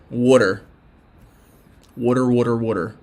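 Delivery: Philly "water", said over and over in his own accent. Shown as a demonstration. For a fan who loves it, repeating water in a Philly accent. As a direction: roll out "Water" with a broad Philly vowel, like a hometown demo. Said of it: "I'm gonna say water".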